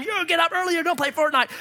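mock yelling at kids. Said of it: "You're yelling and screaming at them".

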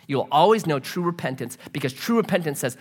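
normal talking voice

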